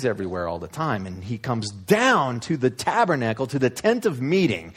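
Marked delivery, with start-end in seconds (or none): none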